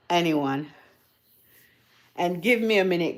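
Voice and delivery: ordinary talk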